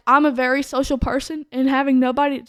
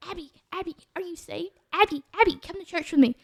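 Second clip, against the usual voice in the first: higher-pitched mock of a pestering friend; sing-song nag, pitched up, lightly mimicked; mocking nagging friend; 4 semitones higher, 6 dB quieter than usual. Friend pestering Abby to come to church, mimicked higher and nagging.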